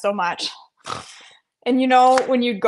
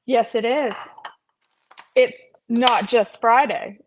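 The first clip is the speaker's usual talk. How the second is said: ordinary delivery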